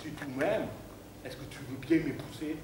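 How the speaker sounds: lightly voiced Portuguese accent; Portuguese suitor. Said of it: "comme il était portugais". (demande en mariage, accent portugais)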